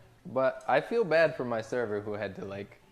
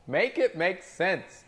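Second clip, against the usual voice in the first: higher, slow, pleading disbelief; drawn-out exasperated plea; 8 semitones higher, 4 dB louder, slower than usual. Shown as a demonstration. Server to chat, exasperated and drawn out. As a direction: stretch it out like begging for logic. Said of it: "repeats phrase two ways".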